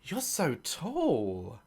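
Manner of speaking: lightly mimicked dreamy girl; swooning girl. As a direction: coo it slowly. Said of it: "They go".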